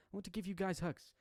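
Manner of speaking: put-on high little girl voice on hugs; child voice retake. Shown as a demonstration. Yuriki promising hugs, redone in child voice. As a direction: redo it as the small child. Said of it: "Almost butchered that there".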